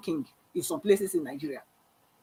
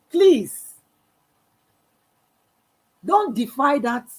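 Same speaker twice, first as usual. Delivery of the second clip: pleading; 6 semitones higher, 6 dB louder, much slower than usual. Real, not acted. Pleading with a leader.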